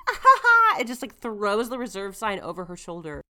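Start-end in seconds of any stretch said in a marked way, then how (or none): 0.1-0.8 high, loud haughty laugh; haughty fake laugh; mock diva laugh. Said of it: "And she's like".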